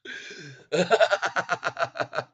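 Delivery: loud, high burst of laughter; hard laugh. Real, not acted. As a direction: crack up loudly at the shorts. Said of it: "I'm laughing so hard".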